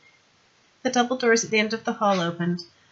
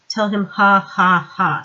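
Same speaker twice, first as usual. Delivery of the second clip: dry mock laugh; 8 dB louder, much slower than usual. Vimes mocks the doctor's message.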